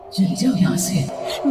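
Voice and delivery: slow, drawn-out eerie warning; hushed ghostly voice. Acted. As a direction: say it like an unseen presence at the ear. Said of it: "दबी हुई आवाज में धीरे से".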